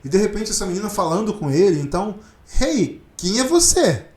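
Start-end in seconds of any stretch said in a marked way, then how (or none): 2.6-4.0 surprised. Retold or imitated. Acts Chris's surprised reply.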